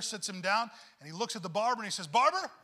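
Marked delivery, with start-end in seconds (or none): 2.1-2.5 a sharp, loud, high call across the shop. Retold or imitated. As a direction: call out Barber loud to get his attention. Pastor calling out to barber.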